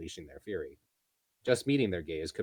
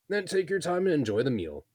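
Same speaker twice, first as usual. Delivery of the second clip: higher, louder friendly innkeeper; 10 semitones higher, 6 dB louder than usual. Acted. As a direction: serve it up like a host. Proprietor speaks.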